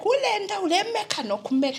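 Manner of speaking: high-pitched imitation of mother urging; chiding mother. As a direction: mimic a mother's high, lively exhortation. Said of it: "And my mom was like".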